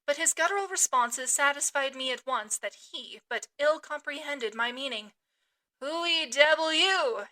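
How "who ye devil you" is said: slow, louder harpooner voice with broken English; a wild cannibal demanding in the dark. Acted. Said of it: "he sa-, at last said".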